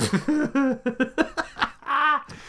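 high burst of real laughter; big high laugh. Real, not acted. Laughing at the murder hobo story. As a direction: cracks up at the memory.

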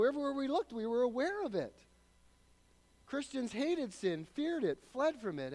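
loud, high, driving sermon voice; fiery pulpit preaching; full-force preaching. Acted. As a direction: preach it out to the whole hall.

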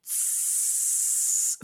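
long slow unvoiced hiss imitating a radiator; describing the radiator, a long quiet steam hiss; heater hiss. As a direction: let out a long soft tss like steam. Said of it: "It just goes".

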